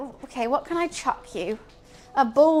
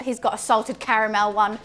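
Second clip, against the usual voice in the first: ordinary talk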